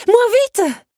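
high, loud plea called after a runner; shrieked plea from behind; imploring cry. A narrator's reading. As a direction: cry out for them to wait. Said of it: "l'implora Belle".

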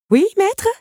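high, loud startled reply; eager servant. A narrator's reading. Clock answering the master's call, eager servant. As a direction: jump to it with a rising question.

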